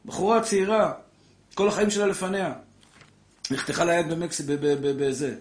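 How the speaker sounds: loud and high, fervent sermon; preaching at full force. Acted. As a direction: rouse the crowd with a fiery story.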